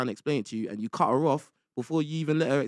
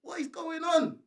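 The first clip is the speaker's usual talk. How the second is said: raised exasperated voice; 8 semitones higher than usual. Real, not acted. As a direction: throw up your hands in disbelief. Host exasperated at guest.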